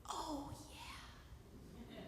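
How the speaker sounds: breathy aside whisper; hushed agreeing aside. Real, not acted. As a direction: drop to a knowing whisper. Aside after accepting offer.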